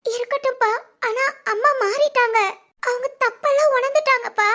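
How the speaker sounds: high-pitched, anxious appeal; earnest pleading. Acted. Daughter telling father that mother has changed, pleading. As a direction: plead urgently on another's behalf.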